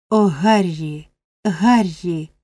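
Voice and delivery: slow, anguished cry of a name. A narrator's reading. Rose in tears appeals to Harry, crying out. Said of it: "скрикнула дівчина".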